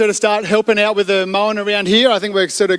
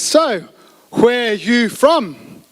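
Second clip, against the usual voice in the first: word by word, higher pitched, very slow; really slow speech; 5 semitones higher, much slower than usual. Acted. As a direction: draw out each word as if to a foreigner. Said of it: "just speak really slowly".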